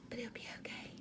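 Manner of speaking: whispered aside under the breath; conspiratorial whispered reassurance. Real, not acted. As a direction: let it slip out as a private whisper. Whispered aside about hurting feelings.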